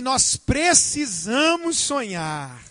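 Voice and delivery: "Nós precisamos sonhar" said slowly and firmly; slow, firm line to spouse. Shown as a demonstration. Pastor models the line couples should say, slow. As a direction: hand them the words slowly. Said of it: "Fala assim".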